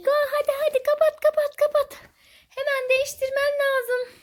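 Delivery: high-pitched urgent mock horror; playful panic. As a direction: hurry the child along in pretend panic. Playful panic over dirty nappy.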